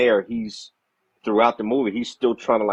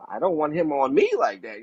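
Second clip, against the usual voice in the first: higher-pitched mock alarm; mock freaked-out reaction; 8 semitones higher than usual. Recalling a creepy childhood movie memory, mock freaked-out. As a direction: act out squeamish dread, half joking. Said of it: "And I'm just like".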